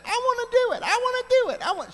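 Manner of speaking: put-on very high, loud, whiny child voice begging; pleading little sister. Five-year-old sister begging to work the mailbox combination, in a very high whiny child voice. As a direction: squeal it like a five-year-old who wants a turn.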